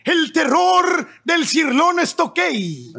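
slow booming ring announcer shout; lucha libre announcer. Pretend. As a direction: stretch the name out like a wrestling ring call. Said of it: "los voy a presentar como pinche anunciador de lucha libre".

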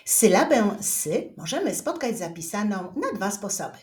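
ordinary talk, nothing marked

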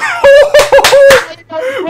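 high, very loud whoop; long wordless goal scream; ecstatic celebratory scream. Real, not acted.

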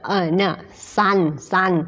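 normal talking voice

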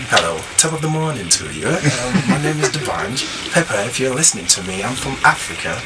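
low, soft crooning voice; deep romantic serenade to Pepper; deep seductive voice. Pretend. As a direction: woo Pepper in a low, smooth voice. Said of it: "Let me check my voice again".

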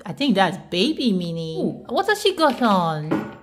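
ordinary talk

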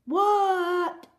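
high, loud, drawn-out shout back; shouted answer. Acted. Froggy answering his mother, loud high shout. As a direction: holler back to a calling parent.

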